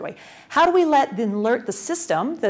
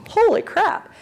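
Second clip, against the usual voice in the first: high, loud mock astonishment; retold wow reaction, pitched way up; amazed exclamation; 11 semitones higher, 5 dB louder, slower than usual. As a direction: blurt it out, acting stunned. Acted amazed reaction to the figure.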